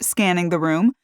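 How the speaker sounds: usual speaking voice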